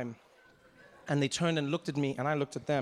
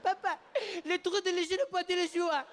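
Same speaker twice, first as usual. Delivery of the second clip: put-on child's voice; 13 semitones higher than usual. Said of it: "And he's like".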